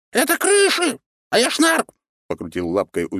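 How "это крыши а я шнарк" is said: tiny high rat squeak; squeaky rat voice. Acted. Rat correcting the hero.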